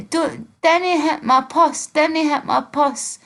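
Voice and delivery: high-pitched broad Dundonian; slow, high Dundee dialect impression; Dundee accent. Shown as a demonstration. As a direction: do a mock Dundee voice, high and drawn out. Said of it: "they'll go like".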